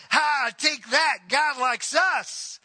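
drawn-out smug jeer; smug taunt. Retold or imitated. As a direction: Israel taunting Judah, gloating slowly. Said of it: "they're looking at Judah going".

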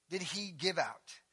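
ordinary delivery

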